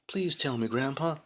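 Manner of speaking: put-on high pleading kid voice; begging child voice. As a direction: beg like an eager child. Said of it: "I begged".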